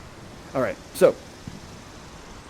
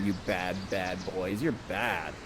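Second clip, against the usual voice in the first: low, soft mock reproach; teasing scolding; 10 semitones lower, 9 dB quieter, slower than usual. Storyteller teases the audience villains, low mock scolding.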